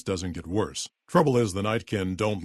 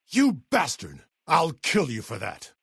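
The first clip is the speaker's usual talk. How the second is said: high-pitched outraged battle curse; angry curse; 5 semitones higher than usual. Acted. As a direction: curse the attacker. Cursing an attacker in combat.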